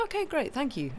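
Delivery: a surprised little yelp of thanks; taken aback. Retold or imitated. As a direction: squeak it out, caught off guard. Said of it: "and I was like".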